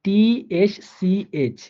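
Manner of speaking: টিএসসিএইচ spelled letter by letter, very slowly; drawn-out letter names টিএসসিএইচ. Shown as a demonstration. Teacher spelling টিএসসিএইচ slowly for students. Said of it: "এরপর আসেন".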